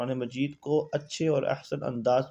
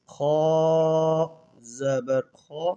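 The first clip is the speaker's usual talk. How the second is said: Quranic recitation drill; much slower than usual. Shown as a demonstration. Teacher chanting the lesson's letters for students, drawn out. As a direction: intone each letter slowly like a recitation.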